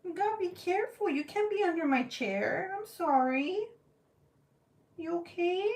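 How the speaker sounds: high-pitched fussing over an animal; pet talk. Real, not acted. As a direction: scold and comfort a pet in a high voice. Apologising to pet caught under chair.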